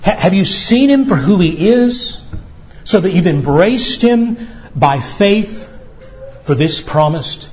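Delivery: normal talking voice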